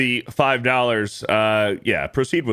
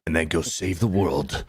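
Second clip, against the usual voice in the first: lightly voiced action-hero line, a bit low; hint of a mock action-star voice; movie tough guy; 3 semitones lower than usual. Voicing Statham's character in Crank.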